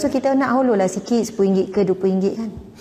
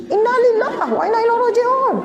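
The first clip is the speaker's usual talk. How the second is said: mock exasperated outburst; 13 semitones higher than usual. Shown as a demonstration. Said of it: "Ha, ke tinggi sikit".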